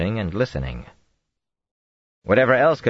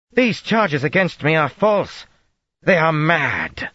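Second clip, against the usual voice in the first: much higher, slow, strained; desperate denial; 11 semitones higher, 5 dB louder, slower than usual. A narrator's reading. Accused queen denying charges to a silent lord, desperate. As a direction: protest as fear creeps in.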